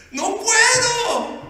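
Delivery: very high, stretched-out cry; anguished refusal. Acted. Husband who lost ten years' work refusing forgiveness, anguished. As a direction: wail it in acted despair.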